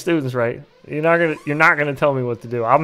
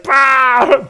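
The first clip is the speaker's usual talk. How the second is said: mocking bah; 11 semitones higher, 8 dB louder than usual. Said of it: "pointing and laughing at her".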